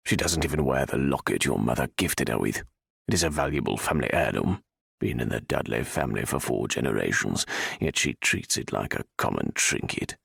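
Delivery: low, scornful aristocrat; stern old lord. A narrator's reading. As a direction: look down on her with contempt. Viscount disgusted.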